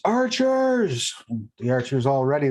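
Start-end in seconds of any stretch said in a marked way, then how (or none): 0.1-1.2 high-pitched, drawn-out battle call; shouted command. Acted. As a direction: call the archers to arms. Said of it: "the Hollow Knight shouts".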